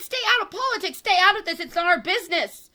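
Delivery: high, forceful quoting of Jesus; heated preaching. Real, not acted. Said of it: "I get a little heated".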